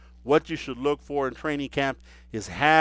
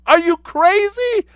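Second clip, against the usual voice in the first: high, squealing schoolgirl disbelief; giggly schoolgirl; 8 dB louder than usual. Retold or imitated. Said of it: "I almost giggle like a little schoolgirl and be like".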